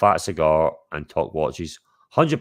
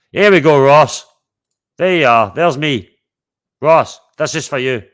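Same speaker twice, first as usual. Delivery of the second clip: excited showing off; 7 semitones higher, 8 dB louder than usual. Real, not acted. Showing off a picture.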